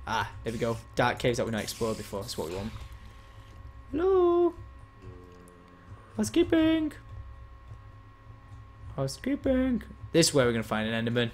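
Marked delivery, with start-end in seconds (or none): 3.9-9.8 housekeeping call. Pretend. Calling into a dark cave.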